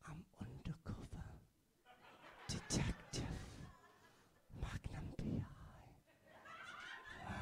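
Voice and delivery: slow, secretive whisper. Shown as a demonstration. Comedian whispering to a drunk who points at his tash, secretive. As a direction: breathe it like a secret agent. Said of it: "whisper, and I go".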